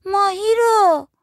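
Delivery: put-on high, loud, slow little-kid voice; small child. A narrator's reading. Child character answering from the room. (صدای نازک و بلند بچه، کشیده)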